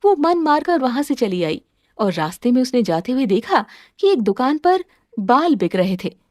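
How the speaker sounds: ordinary delivery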